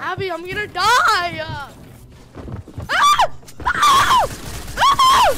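very high, loud terrified screams; panicked screaming. Real, not acted. About to die in lava.

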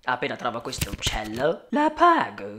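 whispered aside, unvoiced. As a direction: slip it in as a secret whisper. Lawyer whispers offer of payment. (sussurrato di nascosto)

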